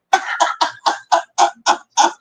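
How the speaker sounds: shrieking high laugh. Real, not acted. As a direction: squeal with laughter. Laughing at own retelling.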